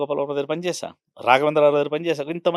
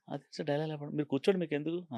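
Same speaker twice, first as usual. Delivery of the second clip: much softer, offhand "అది sir dialogue paper"; soft dismissive reply; 10 dB quieter than usual. Retold or imitated. Director quietly brushes off the actor's demand for lines. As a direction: wave the question away quietly.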